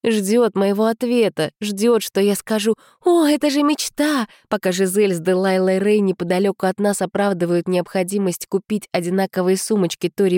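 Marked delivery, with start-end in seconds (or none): 3.0-4.3 mock gushing. Mock expected gushing reply.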